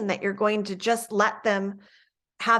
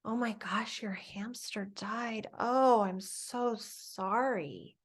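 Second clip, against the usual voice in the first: soft, slow sorrowful whisper, much softer; sad whisper; 9 dB quieter, slower than usual. Shown as a demonstration. Teacher comforts a student whose hamster died, in a sad whisper. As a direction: hush your voice as if sharing a sad loss. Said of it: "you might reflect that in your voice".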